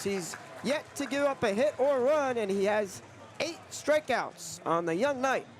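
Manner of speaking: normal talking voice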